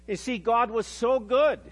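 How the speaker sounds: usual speaking voice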